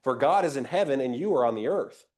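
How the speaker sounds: ordinary talk, nothing marked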